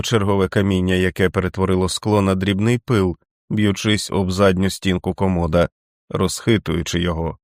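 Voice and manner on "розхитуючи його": ordinary delivery, nothing marked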